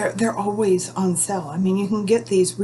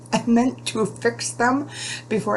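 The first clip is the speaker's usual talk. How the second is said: laughing while talking; 3 semitones higher than usual. Real, not acted. Laughs at her own unpainted nails.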